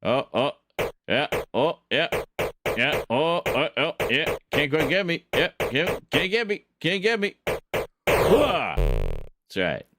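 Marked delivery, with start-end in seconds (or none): 0.0-7.3 high singsong can't-catch-me taunt. Pretend. Taunting opponent while dodging shots. 8.1-8.8 high, loud military hooah; loud gung-ho soldier. Pretend. Cheering after winning the duel.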